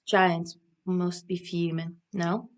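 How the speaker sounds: usual speaking voice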